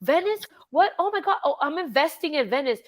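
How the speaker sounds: high, flustered fake innocence; caught-out husband covering badly; flustered DiCaprio. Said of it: "And he goes".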